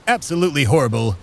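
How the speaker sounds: ordinary delivery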